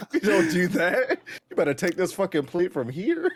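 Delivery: laughing through a mock scolding; cracking up while playing the strict host. Laughing while playing a strict family host telling guests to take a plate.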